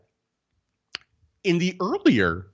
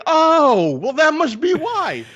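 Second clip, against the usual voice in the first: high, drawn-out "oh" of discovery; hammed-up dawning understanding; loud high "oh", then slow and high; mock realization; 12 semitones higher, 4 dB louder, much slower than usual. Acts out assistant's sudden realization.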